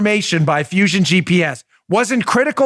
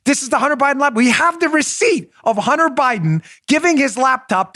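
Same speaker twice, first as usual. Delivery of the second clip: high mock spy voice, ranting on; Austin Powers voice; 5 semitones higher than usual. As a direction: keep the groovy spy voice while listing the evidence. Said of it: "He's like".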